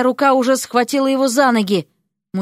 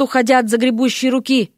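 plain reading voice

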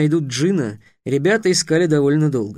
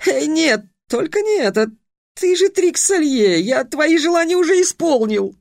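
yelling in dismay; 12 semitones higher than usual. A narrator's reading. Genie recognises Trix, high panicked yell. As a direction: howl at seeing Trix. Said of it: "он завопил".

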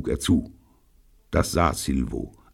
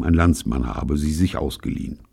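ordinary delivery

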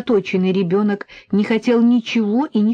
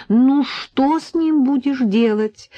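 higher, slow exasperated lament; mildly upset; 7 semitones higher, 3 dB louder, slower than usual. A narrator's reading. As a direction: throw up your hands, mildly distressed. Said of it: "расстроенно сказала Надя". (немного расстроенно, протяжно)

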